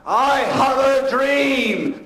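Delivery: very slow, booming, high orator's voice; hammed-up orator who fills the whole room; Martin Luther King impression. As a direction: boom it out like a civil rights preacher, very slowly. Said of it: "doing a Martin Luther King job".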